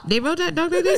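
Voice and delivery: high mock Pakistani accent. Asking how two peoples talk, joking Pakistani accent. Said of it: "Pakistani accent".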